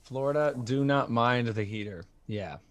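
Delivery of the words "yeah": usual speaking voice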